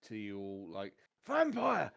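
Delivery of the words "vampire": high, loud scream of warning. Acted. Fleeing captain warns you all, screaming out. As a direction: scream it out to everyone around. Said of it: "He's just screaming out".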